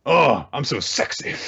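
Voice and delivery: high, preening show-off voice; playful moans and gloating; mocking taunt. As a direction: ham up a self-admiring villain dance. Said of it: "doing this mocking taunt thing".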